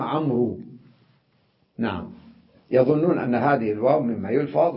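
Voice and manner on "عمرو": عمرو as "Amroo", waw sounded; mispronounced waw. Shown as a demonstration. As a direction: end عمرو on a long oo. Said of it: "أكثرهم يلفظوها". (نطق «عمرو» بإظهار الواو خطأً)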